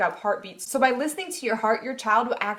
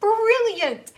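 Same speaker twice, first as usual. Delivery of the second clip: very high, loud exclamation of admiration; delighted; 13 semitones higher, 7 dB louder than usual. Retold or imitated. Mom watching her cousin's wife parent, delighted and loud. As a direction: burst out in admiration. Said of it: "And I was like".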